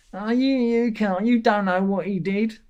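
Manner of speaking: high whiny mock voice, slowed; mocking commenter. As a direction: whine like a fanboy defending his idol.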